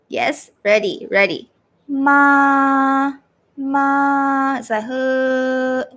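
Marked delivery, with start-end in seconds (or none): 1.9-4.6 "Ma" held long on one flat, level note; first tone, flat. Shown as a demonstration. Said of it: "this one is a flat". 4.8-5.8 "Huh" held on one level note; very flat tone. Shown as a demonstration. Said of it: "very flat".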